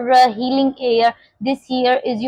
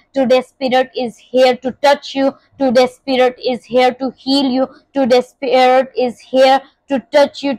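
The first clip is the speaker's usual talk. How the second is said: ordinary delivery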